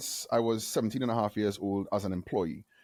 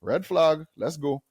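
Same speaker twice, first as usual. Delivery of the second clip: wry aside; 4 semitones higher, slower than usual. Retold or imitated. His wry inner reaction.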